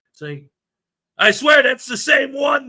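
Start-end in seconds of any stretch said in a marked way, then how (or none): 1.2-2.7 slow, louder and higher insistence; exasperated outburst. Real, not acted. Streamer fed up with a fly that won't die, exasperated.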